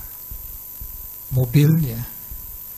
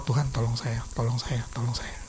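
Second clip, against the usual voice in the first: soft, pleading prayer under the breath; quiet retold prayer; 6 semitones lower, 9 dB quieter than usual. Speaker recalls praying quietly while his friend loses at the jackpot, soft pleading. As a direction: beg God softly for help. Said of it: "Saya cuma doa".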